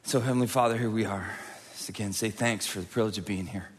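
low, soft pastor's prayer. Acted. Pastor praying over the congregation, soft low prayer. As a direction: pray gently over the room.

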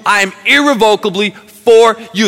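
loud, high fired-up pulpit voice; full-force preaching. Acted. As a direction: preach it out to the whole room.

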